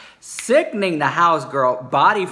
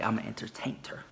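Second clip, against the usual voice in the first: whispered quote; 3 semitones lower, 11 dB quieter, faster than usual. Retold or imitated. Quoting friend's catchphrase.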